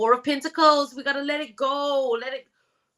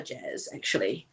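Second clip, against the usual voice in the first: ordinary delivery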